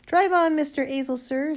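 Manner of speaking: high, loud policeman's order; commanding shout. A narrator's reading. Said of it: "shouted Sergeant Samways through the window in his most commanding policeman's".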